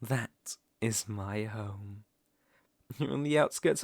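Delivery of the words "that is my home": usual speaking voice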